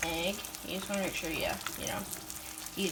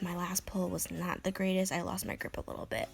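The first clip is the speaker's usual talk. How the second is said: hushed, breathy gym whisper; 3 semitones lower, 6 dB quieter than usual. Real, not acted. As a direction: whisper it under your breath. Quietly reporting a bad lift.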